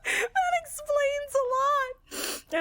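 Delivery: high, very slow, cry-laughing words; wheezing it out through tears of laughter. Real, not acted. Repeating the rude guy's punchline to chat, cry-laughing.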